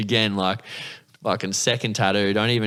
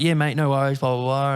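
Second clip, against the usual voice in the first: higher-pitched easygoing imitation; breezy agreement; 5 semitones higher than usual. Bloke agreeing to introduce his boss, breezy agreement. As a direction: rattle off a mate's casual yes.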